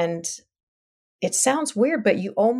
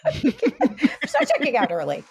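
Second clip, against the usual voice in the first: laughing through the words, higher and lighter; 7 semitones higher than usual. Real, not acted. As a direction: chuckle it out despite the sadness. Laughs at husband checking out.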